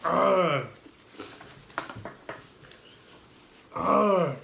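pained groans, tongue on fire; mouth burning from spicy food. Real, not acted. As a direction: groan through the burning heat. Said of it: "My tongue".

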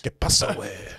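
lightly voiced Mexican accent; a hint of a Mexican accent. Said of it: "do a Mexican accent".

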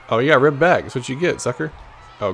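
ordinary delivery

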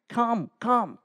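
hushed, coaxing come, come; quiet plea after the shout; soft pleading. Acted. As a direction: draw them in quietly. God's soft pleading invitation.

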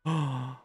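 short audible gasp; surprised gasp. Acted. Streamer hearing of a return to Unova, surprised gasp. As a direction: gasp at the big news.

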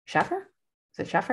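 SHAFFER with a short a, rising as a question. Shown as a demonstration. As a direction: ask "Shaffer", first vowel as in "cat". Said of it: "I say Shaffer".

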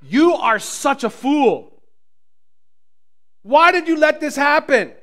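loud, high, slow berating; scornful scolding. Pretend. Jesus never said this, scolding the freed man. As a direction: shame him like a fool from above. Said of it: "did not say to the man".

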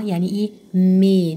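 usual speaking voice

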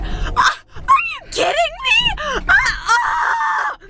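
frustrated yell. Real, not acted. Venting in the car about rude women, a high frustrated yell. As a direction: screech out the annoyance.

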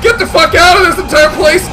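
high, loud yell of alarm; frantic, rising panic; panicked shouting. Real, not acted. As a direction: shout while fleeing in terror. Fleeing monster in game.

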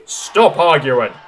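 loud, slow grumble; grumbling. A narrator's reading. Late passengers interrupt the argument, grumbling. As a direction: complain loudly and impatiently. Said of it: "grumbled the passengers".

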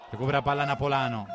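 ordinary talk

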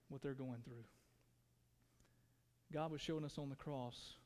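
soft, hushed sermon voice; gentle reflective preaching; quiet intimate preaching. Acted. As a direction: draw them in with a low, soft voice.

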